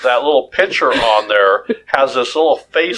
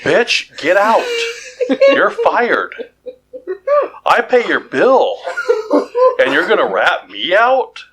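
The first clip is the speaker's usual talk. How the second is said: mock furious yelling; 12 semitones higher, slower than usual. Yelling at the snitch fridge.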